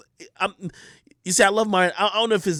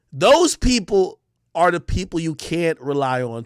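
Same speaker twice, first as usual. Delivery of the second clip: ordinary talk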